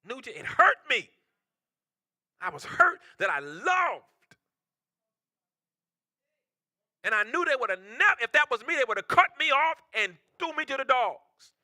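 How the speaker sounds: a loud sermon crescendo; full-force preaching. Acted.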